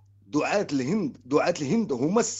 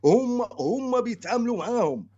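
pitched up and pressing; insistent, raised pitch; 6 semitones higher than usual. Real, not acted. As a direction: insist hard, voice high. Caller insists heatedly, high.

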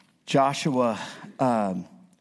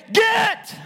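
high, forceful one-word shout; explosive order to leave; shouted command. Acted. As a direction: drive it off with one sharp yell. Acts out ordering the devil away.

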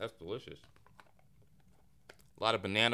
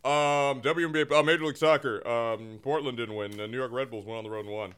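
sports announcer; 2 semitones lower than usual. Acted. Bagman sports report segment.

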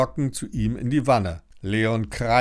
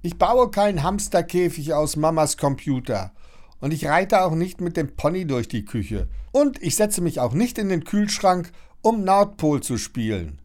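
calling back; 8 semitones higher than usual. A narrator's reading. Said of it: "Von ferne ruft sie noch".

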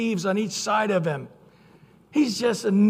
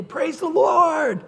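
higher, louder, slow joyful shout; excited campus greeting; 10 semitones higher, 6 dB louder, slower than usual. Retold or imitated. Student greeting friends across the campus, shouting with joy. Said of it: "give the one-way sign and go".

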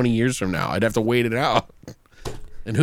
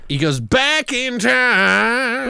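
high, hugely drawn-out words; mock time-travel drama; 11 semitones higher, much slower than usual. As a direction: stretch it like a silly sci-fi effect. Repeats drawn-out time-travel bit.

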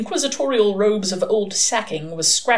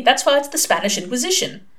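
mildly firm, higher pitched; 6 semitones higher than usual. A narrator's reading. Adam explaining the Spanish Inquisition to friends, firm. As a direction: settle the point firmly. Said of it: "said Adam firmly".

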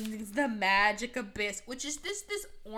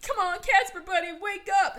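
1 mock pleading; 7 semitones higher, 7 dB louder than usual. Pleading over unconscious friend.

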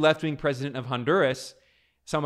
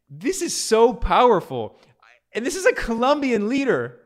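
excited; 8 semitones higher, 5 dB louder than usual. Real, not acted. Enthusing about the speech.

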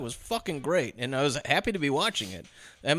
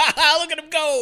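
high, loud gleeful mock laugh-line; high cackling delight at a falling man; gleeful villain cackle; 7 dB louder than usual. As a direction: gloat like a delighted killer. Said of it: "it's just like".

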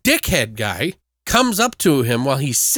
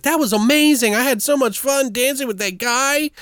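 put-on much higher, breathless-excited girl parody; mock gushing girlfriend; 10 semitones higher than usual. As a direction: gush about the dance, pitched up. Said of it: "She's like".

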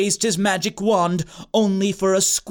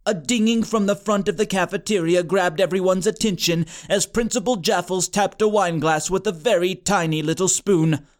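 ordinary delivery, nothing marked

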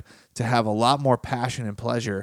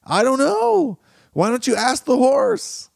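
lightly voiced rider, high and loud; a hint of a clueless horseman; carefree rider; 12 semitones higher, 4 dB louder than usual. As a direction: shrug it off, high and breezy. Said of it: "The rider replied".

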